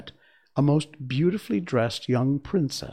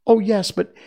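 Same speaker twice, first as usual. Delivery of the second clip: much higher small voice; high princess voice; 9 semitones higher, 7 dB louder than usual. Acted. Princess explaining to Dorothy, small high voice. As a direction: voice the tiny china princess.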